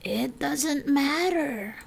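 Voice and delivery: soft, slow reassurance; calm her down gently; calmly. A narrator's reading. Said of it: "Missus Willow said calmly".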